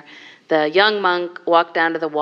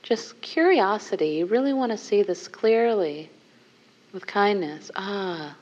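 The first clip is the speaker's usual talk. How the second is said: guided meditation; 2 semitones lower, 4 dB quieter than usual. Acted. Teacher guiding the group into a seated contemplation, softly. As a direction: soften and guide them gently inward.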